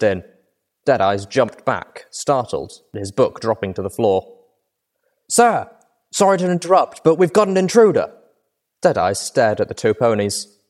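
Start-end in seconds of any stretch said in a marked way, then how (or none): 5.3-8.1 loud, high alarmed report; alarm shout. A narrator's reading. Guard bursts in with news.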